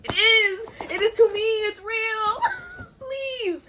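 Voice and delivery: very high, tearful protest; desperate wail. Real, not acted. Reader insisting the romance is real, desperate wailing. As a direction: wail and plead, half laughing.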